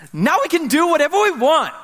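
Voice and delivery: put-on high delighted child announcing his discovery; Seth's excited kid voice. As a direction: crow it like a thrilled four-year-old. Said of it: "And he goes".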